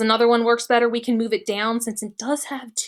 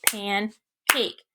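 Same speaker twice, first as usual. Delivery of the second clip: pancake said slowly, syllable by syllable; slow syllable clapping; 4 dB quieter than usual. Shown as a demonstration. Teacher claps out pancake syllables for class, slowly. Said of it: "we clap it out".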